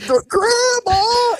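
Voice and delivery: high strained gasping cry of Grandma; mock groaning, winded brother. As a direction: wheeze it out like you just got punched in the stomach.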